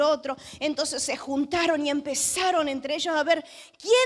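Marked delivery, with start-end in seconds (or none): 3.8-3.9 high, drawn-out questioning cry; accusing demand. Acted. Preacher as sailors hunting the guilty one, accusing. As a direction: act out a suspicious demand for the culprit.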